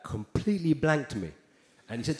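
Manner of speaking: usual speaking voice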